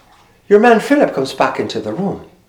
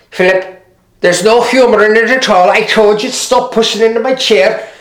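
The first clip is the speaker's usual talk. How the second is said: put-on scolding old mother; 4 semitones higher than usual. Agnes scolding son-in-law.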